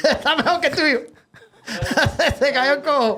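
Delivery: talking through laughter; can't stop laughing. Real, not acted. Friend laughing at the joke's punchline. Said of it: "laughing reaction".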